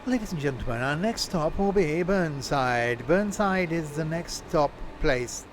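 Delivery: slow, formal announcer voice; train announcement. Pretend. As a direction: announce the stop to passengers.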